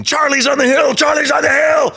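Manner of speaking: high, loud shouted warning; screaming alarm. Retold or imitated. Stranger bursts in yelling to the riders, high frantic shout. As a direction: scream it out like a panicked ranch hand. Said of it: "started screaming".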